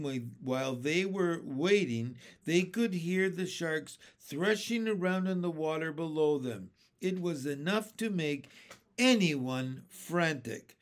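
ordinary delivery, nothing marked

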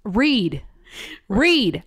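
"Read" as a punched one-word command; exasperated emphasis. Real, not acted. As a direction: hit "Read" hard, on its own.

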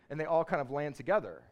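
ordinary delivery

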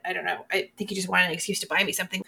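normal talking voice

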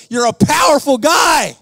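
high, drawn-out rallying exclamation; retold booming compliment, punched out; hearty shout. Preacher slapping someone's shoulder after speaking, retold hearty shout.

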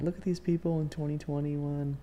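high-pitched mock amazement at the past; mocking future viewer. Future viewer looking back at these people, high mocking voice. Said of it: "Someone's gonna be like".

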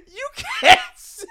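very high, loud, slow disbelief; appalled protest. Retold or imitated. Storyteller telling his friend off, appalled=. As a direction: stretch out a scandalized replayed protest.

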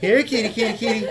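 high sing-song here kitty call; calling a lost pet. Acted. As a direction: coax the cat in a high lilting voice. Calling the cat again.

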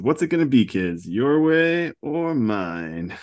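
slow, menacing drawn-out threat; doctor slowly threatening captured kids; villain doctor. Retold or imitated. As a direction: savor each word like a villain. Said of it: "So the doctor says".

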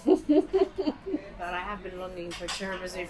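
laughing. Real, not acted. Laughing about her tiramisu craving.

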